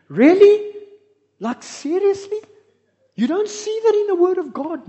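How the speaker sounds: high, loud disbelief; loud, high incredulous "Really? Like, seriously?"; incredulous outburst. Real, not acted. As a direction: scoff at the idea, loud and high.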